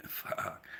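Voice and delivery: a breathless whispered fuck; soft unvoiced swear; whispered curse. Real, not acted. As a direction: drop it to a whisper under your breath. Muttered aside after laugh.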